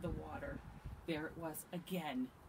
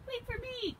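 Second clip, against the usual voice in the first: high, thin cry to be waited for; Mouse; 5 dB louder than usual. A narrator's reading. Little mouse trails the others in woods, high cry. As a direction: call out in a tiny high voice. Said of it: "cried Mouse".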